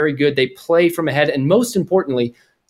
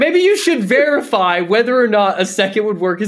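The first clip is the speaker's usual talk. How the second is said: mock teacher voice; 7 semitones higher than usual. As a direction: put on a prim teacher grading homework. Pretend teacher answering the student.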